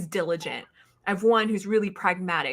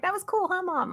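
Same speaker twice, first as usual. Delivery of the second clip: daughter's voice; 12 semitones higher, 4 dB quieter than usual. Retold or imitated. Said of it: "She was like".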